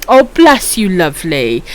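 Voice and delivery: ordinary talk, nothing marked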